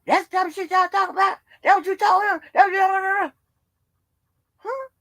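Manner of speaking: mimicked accusing voice, high and pressed; JoJo. Acting out JoJo's angry accusation.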